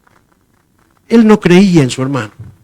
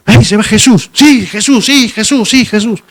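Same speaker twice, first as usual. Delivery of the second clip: high, eager cries; a disciple swept up in the group vision; excited vision; 6 semitones higher than usual. Pretend. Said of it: "lo que yo digo de la humedad".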